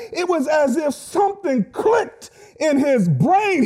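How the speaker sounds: rising, shouted sermon climax; preaching at full force. Acted. Pastor preaching to the congregation, building to a shout.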